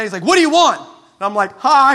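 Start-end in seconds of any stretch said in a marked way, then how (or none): 0.2-0.8 loud, high angry demand; mad. Acted. Psychic answering a stranger's knock, mad. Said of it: "the guy answers all mad". 1.6-1.9 loud, high-pitched awkward greeting. Acted. Visitor facing an angry man at the door, awkward. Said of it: "The most awkward hi in the world".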